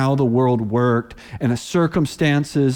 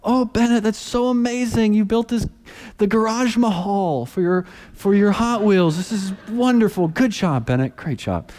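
gushing praise; 9 semitones higher than usual. Retold or imitated. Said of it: "And he go over".

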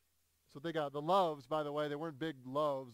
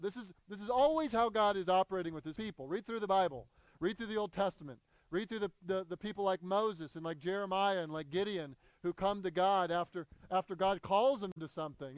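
louder preaching push; 3 semitones higher, 4 dB louder than usual. Acted. Pastor urging his church, raised preaching voice. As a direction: drive it home to the congregation.

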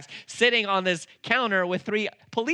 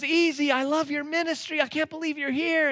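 higher, eager fan of the ministry; excited officer; 11 semitones higher than usual. Retold or imitated. Officer to the preacher, higher and delighted. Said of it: "He goes".

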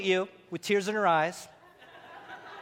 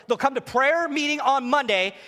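usual speaking voice

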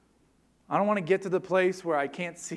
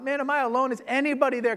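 high, loud desperate call into the wind; lost hiker yelling; 8 semitones higher, 4 dB louder, faster than usual. Acted. Lost hiker in a storm yells for help, high and loud. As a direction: shout for help into a blizzard. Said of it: "they finally yell out".

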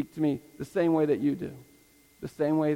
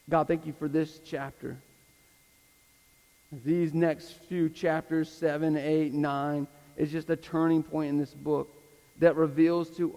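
public prayer. Real, not acted. Pastor asks God to grow the congregation, earnest closing prayer. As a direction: pray it out phrase by phrase, with long pauses.

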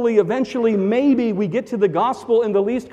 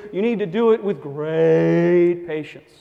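a hint of the stained glass voice, slowed; homage to the preacher; 3 semitones lower, slower than usual. Pastor briefly mimicking the affected preacher voice. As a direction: lightly intone it like the other preacher. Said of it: "That was an homage to the other preacher".

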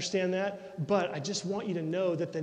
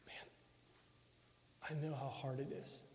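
gentle Jesus voice; 7 dB quieter than usual. Acted. Jesus speaking tenderly to someone suffering, soft and comforting. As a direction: hush down and comfort someone who is hurting.

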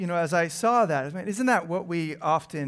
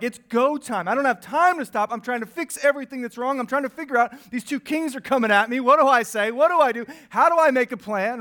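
panicked; 6 semitones higher, 5 dB louder, faster than usual. Retold or imitated. Voicing panicked inner thoughts.

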